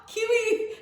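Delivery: high, loud Aussie call; Australian accent. Pretend. Reader shouting the card name in Aussie accent. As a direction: squeal it in a cartoon Australian accent. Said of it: "an Australian accent".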